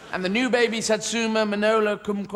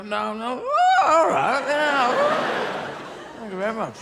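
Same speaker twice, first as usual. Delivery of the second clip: coy acceptance; 6 semitones higher, slower than usual. Acted. Reluctant acceptance.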